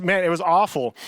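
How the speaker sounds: ordinary talk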